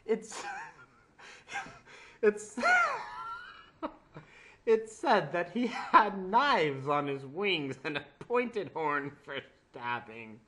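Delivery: slow, loud, giggling read; laughing through it. Real, not acted. Reader pushing through the story, still laughing. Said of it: "I can't do this".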